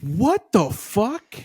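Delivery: slow, rising disbelief, each word dragged out; drawn-out outraged disbelief building to a high squeak; can't believe what he's describing; incredulous. Real, not acted. Incredulous rant about dog pee.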